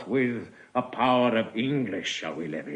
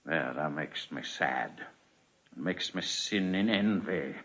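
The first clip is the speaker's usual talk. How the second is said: much softer and lower, a heavy-hearted admission; sorrowful confession; 9 semitones lower, 8 dB quieter than usual. Acted. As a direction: let the envy sink into a hushed lament. King laments his son.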